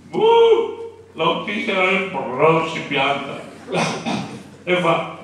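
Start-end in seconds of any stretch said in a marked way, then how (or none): 0.1-1.0 sharp, loud, very high shout; a sudden yelp to startle everyone; high yell. Retold or imitated. The priest, acting out the startling yell on the bus. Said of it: "Io da con l'urlo".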